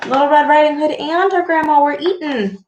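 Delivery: plain reading voice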